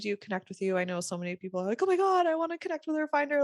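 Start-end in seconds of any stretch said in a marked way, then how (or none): 1.8-3.4 high, rushed gushing; fast, higher-pitched fangirl excitement; gushing fans. Retold or imitated. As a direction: imitate eager fans gushing over someone. Voices eager fans of guest.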